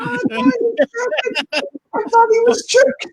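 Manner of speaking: high pitched laugh through words. Real, not acted. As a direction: squeal it out laughing. Laughing hard.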